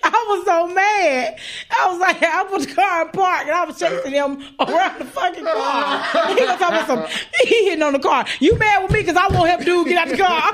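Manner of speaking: laughing hard while telling the chase; can barely get through it for laughing. Real, not acted.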